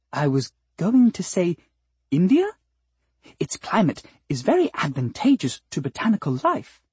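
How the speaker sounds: name the price delicately and sweetly; sweet flower singer. Acted. Flower telling the cat its fee, high and polite.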